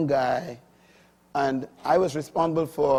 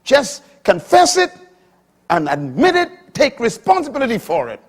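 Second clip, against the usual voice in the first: high, loud pulpit delivery; full-force sermon voice; fiery preaching; 8 semitones higher, 9 dB louder than usual. Acted. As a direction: preach it out to the congregation.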